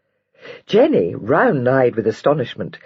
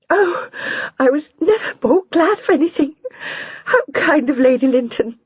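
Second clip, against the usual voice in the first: much higher, through happy tears; tearful, disjointed; 13 semitones higher than usual. A narrator's reading. Jenny greets Lydia and Adam, crying with joy. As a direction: gasp it out in broken bursts. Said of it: "uttering disjointedly".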